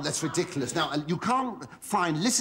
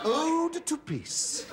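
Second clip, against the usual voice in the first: grand recital; 8 semitones higher, much slower than usual. Acted. Reciting the title of his ode.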